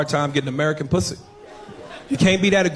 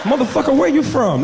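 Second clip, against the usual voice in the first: high, loud mock-female exclamation; delighted woman; 9 semitones higher, 5 dB louder than usual. Woman reacting to the English accent, excited high voice. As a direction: burst out high, hammed-up and impressed.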